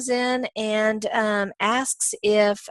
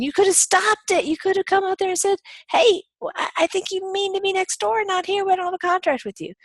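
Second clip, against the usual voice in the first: much higher, louder scolding judge voice; stern court; 13 semitones higher, 3 dB louder than usual. Retold or imitated. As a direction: scold as the court would, pitched up and loud. Court voicing scolding homeowner.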